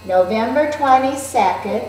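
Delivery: put-on slow, steady read-aloud alongside a young reader; teacher reading aloud together with Riley; reading along with a child. Shown as a demonstration. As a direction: keep pace with the child, smooth and even. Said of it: "let's read it with Riley".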